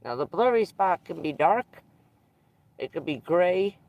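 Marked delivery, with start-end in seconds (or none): none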